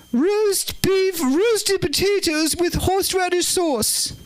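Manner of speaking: high, slow regal menu reading; high-pitched royal impression, drawn out; the Queen. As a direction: announce the dishes in a high, stately royal voice. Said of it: "We'll do the Queen tonight".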